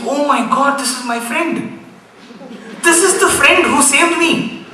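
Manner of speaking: overwhelmed to find his friend in stone; astonished realization building up; awestruck. Retold or imitated.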